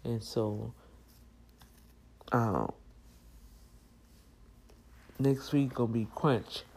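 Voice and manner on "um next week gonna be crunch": ordinary talk, nothing marked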